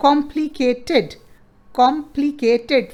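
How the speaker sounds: "complicated" slowly split into four, com-pli-ca-ted; syllable by syllable. Shown as a demonstration. Teacher counts syllables for pupils, slow syllable by syllable. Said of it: "four syllable".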